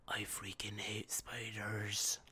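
hushed whisper of disgust. Real, not acted. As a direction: breathe it as a near-silent whisper. Aside about hating spiders.

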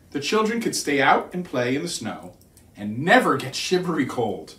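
ordinary delivery

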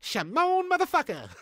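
very high, loud parody pop-star squeal; hammed-up falsetto impression. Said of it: "Can you do [name] off Bo Selector".

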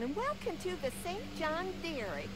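a strong Southern hostess welcome; Southern accent. Acted. Southern host greeting visitors, thick Southern accent. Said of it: "the accent is so strong".

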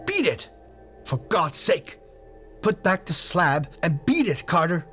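high desperate cries; begging in terror; piteous cry. A narrator's reading. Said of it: "a piteous cry from Warren".

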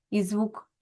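ordinary delivery

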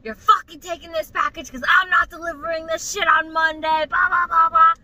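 mock yelling that runs into blah-blah; retold angry yelling. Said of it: "he's still screaming and yelling".

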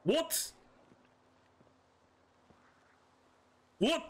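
high, sharply rising query; hard of hearing. Pretend. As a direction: ask like you missed every word. I can't hear you.

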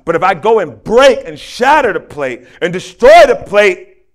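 loud, punching the destructive verbs; emphatic destruction. Retold or imitated. Speaker retelling the plate analogy to listeners, loud and emphatic. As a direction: build force on each verb of smashing.

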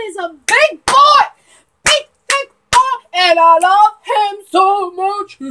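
loud, adoring fan outburst; excited gushing. Real, not acted. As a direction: gush over your favourite, punching out the words. Fan gushing over character.

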